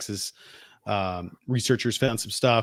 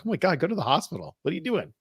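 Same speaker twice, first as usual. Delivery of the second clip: higher-pitched retold exasperated alarm; acted-out dismay, pitched up; 7 semitones higher, faster than usual. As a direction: urge him on in mock disbelief. Retelling his alarm at the injury.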